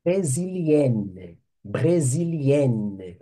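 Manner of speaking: brésilienne said slowly, the final -enne clearly sounded; feminine ending stressed. Shown as a demonstration. Teacher to Portuguese-speaking students, brésilienne with its feminine -enne sounded slowly. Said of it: "Forçar a pronúncia do feminino aqui".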